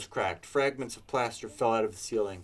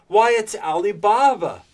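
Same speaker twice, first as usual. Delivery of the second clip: high, loud cry of delighted recognition; ecstatic exclaim; 10 semitones higher, 13 dB louder than usual. A narrator's reading. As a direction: burst out like a thrilled child. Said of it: "Scrooge exclaimed in ecstasy".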